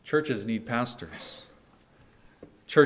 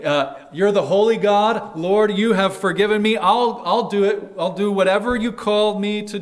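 higher and louder, an eager vow to the Lord; fervent eager pledge; 9 semitones higher, 8 dB louder than usual. Retold or imitated. Isaiah answering God, pledging himself with eager fervour. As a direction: cry it out with eager devotion to the Lord. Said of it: "Isaiah says".

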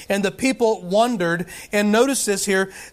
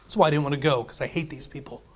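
much softer, lower grumbling Jonah; sulky Jonah; 6 semitones lower, 6 dB quieter, faster than usual. Retold or imitated. Preacher voicing Jonah complaining to God, soft sulky grumble. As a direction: grumble it under your breath like a sulking prophet. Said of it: "Jonah said".

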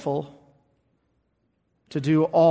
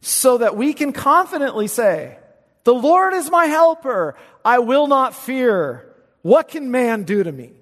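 loud, higher, ringing pulpit proclamation; preaching at full force; 8 semitones higher, 6 dB louder than usual. Acted. Preacher proclaiming Hebrews to his congregation, full force. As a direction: thunder the promise from the pulpit.

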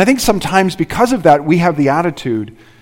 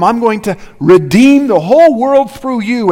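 God's promise, higher and louder; 7 semitones higher, 5 dB louder than usual. Retold or imitated. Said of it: "God had said to Abram".

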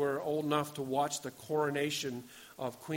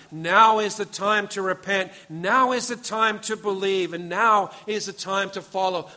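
louder, ringing call with NOW punched; urgent proclamation; 3 semitones higher, 7 dB louder than usual. Acted. Preacher voices Jesus calling people to repent, urgent proclamation. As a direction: press the call to act home.

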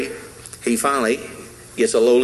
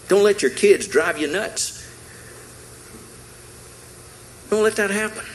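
rising preaching exhortation; 5 semitones higher than usual. Acted. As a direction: pitch climbing higher with each don't let.

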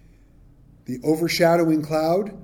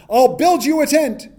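much higher and louder, eager; eager offer; 10 semitones higher, 7 dB louder than usual. Retold or imitated. Peter eagerly offering Jesus a tent, high and loud. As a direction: jump in with an overeager offer.

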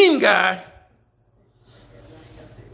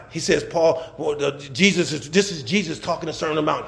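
ordinary talk, nothing marked

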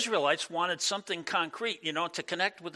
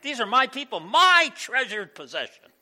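proud God; 8 semitones higher, 10 dB louder than usual. Acted. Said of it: "God is like".

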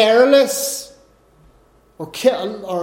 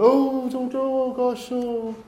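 pleading fan; 3 semitones higher, 5 dB quieter than usual. Retold or imitated. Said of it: "celebrities kind of run away".

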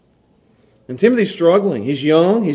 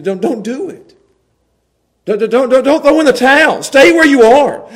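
higher, louder, pressing plea; urgent plea; 7 semitones higher, 7 dB louder than usual. Acted. Paul pleading with Timothy not to quit, urgent. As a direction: stop him from quitting.

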